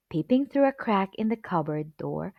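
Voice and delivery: usual speaking voice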